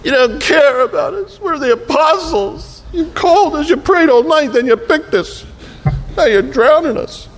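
high, whining complaint; whiny apostles. Acted. Apostles whining at a sleeping Jesus, high and complaining.

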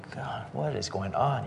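hushed, baffled mutter to himself; quiet bewilderment. Retold or imitated. Sleeper woken by yelling on the train, quietly bewildered.

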